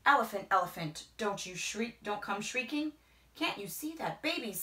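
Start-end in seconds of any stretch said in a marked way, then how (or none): none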